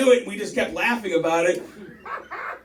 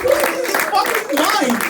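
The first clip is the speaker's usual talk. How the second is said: incredulous; 10 semitones higher, 5 dB louder than usual. Real, not acted. Continuing incredulous rant.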